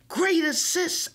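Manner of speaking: a light cheering call; yell. A narrator's reading. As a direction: call out praise across the court. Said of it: "I yell at Drita".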